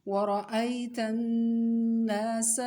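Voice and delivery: teacher reciting for pupils to copy, vowels drawn out; drawn-out recitation. Shown as a demonstration. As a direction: stretch each long vowel a full alif. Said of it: "इसमें आपने क्या देखना है".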